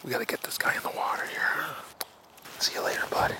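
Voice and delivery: gentle whispered farewell; hushed goodbye to the fish. Real, not acted. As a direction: whisper as you let the fish go.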